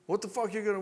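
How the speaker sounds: high-pitched mock outburst from the wife; spicy retort. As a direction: snap back, imitating an angry wife. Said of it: "the fact that she gets spicy".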